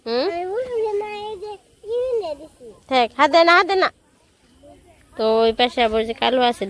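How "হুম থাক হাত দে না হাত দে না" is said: cooing pet talk. Real, not acted. Talking sweetly to pet Bulu. (পোষা প্রাণীর সাথে উঁচু গলায় আদুরে কথা)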